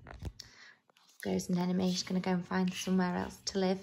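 low, soft, quick commentary; hushed aside. A narrator's reading. Reader to children as friends part, hushed. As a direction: murmur it on an even, low note.

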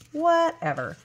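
high, playful brush-off; exaggerated whatever. Real, not acted. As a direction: pitch it way up, falling off at the end. Repeats whatever, drawn out mockingly.

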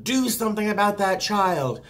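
high, loud mock demand; glaring passenger. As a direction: imitate an irate passenger demanding action. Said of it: "their eyes are burning at me".

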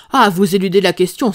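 loud, indignant outburst; angry cry. Acted. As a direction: cry out that he is being dodged. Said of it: "s'écria notre visiteur".

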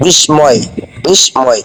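বিস্ময় with the ম sounded, "bis-moy"; spelling pronunciation. Shown as a demonstration. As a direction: say বিস্ময় as written, s then m. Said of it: "এখানে বিস্ময় উচ্চারণ করলে হবে না".

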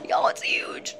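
awed hushed whisper. Real, not acted. Girl staring at a huge spider, whispering. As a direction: whisper it so the spider won't hear.

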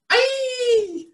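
very high, loud laughing shriek. Acted. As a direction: let out a squealing shriek. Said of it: "shrieked Flap".

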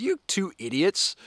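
slow, high-pitched put-down; drawn-out pretend contempt; mock scolding. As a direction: act out a brawler telling off two others. Acting third guy scolding both fighters.

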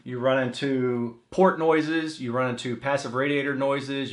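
ordinary talk, nothing marked

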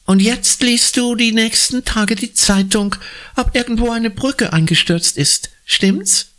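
put-on high, sarcastic boy's voice; mocking tease. Billy teases Anna about her oracle, sarcastic.

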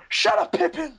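sudden high-pitched mock outburst; Gandalf. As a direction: imitate a short-tempered wizard losing it. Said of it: "he gets mad so easily".

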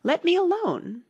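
very high indignant protest; offended outburst. A narrator's reading. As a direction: push them off with a high protest. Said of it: "said Alice indignantly".

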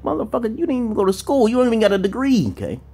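high-pitched exasperated telling-off; animated mock dressing-down, pitched well up; acted street-talk scolding. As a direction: act out chewing out a dropout. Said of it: "I gotta talk like that and shit".

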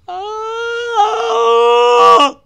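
sudden high scream; startled scream. Real, not acted. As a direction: shriek in surprise. Scream at game sound.

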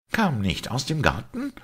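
high-pitched, startled blurted question. A narrator's reading. Simon questions Pater Braun about the garden, blurting it out. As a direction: burst out with the question, pitch leaping up. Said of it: "platzte Simon heraus".

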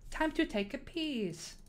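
high, drawn-out jokey call; jokey announcement. Pretend. Streamer joking about the Mario build, silly high drawl.

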